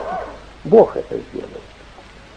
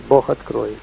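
normal talking voice

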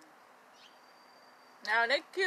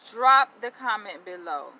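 loud call to viewers; 10 dB louder, slower than usual. Real, not acted. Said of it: "For y'all who are watching".

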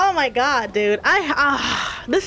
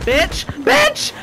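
angry outburst; 7 semitones higher, 3 dB louder than usual. Real, not acted. Raging at game.

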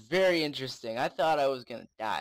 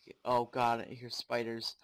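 ordinary delivery

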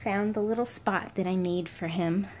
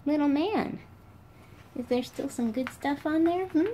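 pet talk; 7 semitones higher than usual. Real, not acted. Cooing at the pet.

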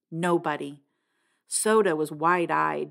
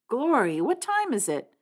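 high, startled question; 7 semitones higher than usual. A narrator's reading. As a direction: wake up with a jolt. Startled waking.

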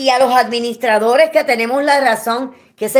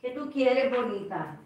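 much softer, gently coaxing; cooing to a pet; 14 dB quieter than usual. Real, not acted. Softly coos to her crying puppies. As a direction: soothe a little dog.

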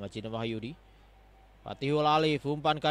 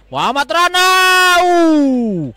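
excited call; 11 semitones higher, 6 dB louder than usual. Real, not acted. Chance near goal.

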